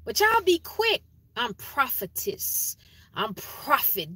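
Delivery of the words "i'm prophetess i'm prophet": mocking boast. Mocking self-proclaimed prophets.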